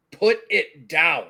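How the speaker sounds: slow, spaced-out command; word by word, firmly; stern order. Acted. T-Rex steps forward and orders the money down, slow and firm.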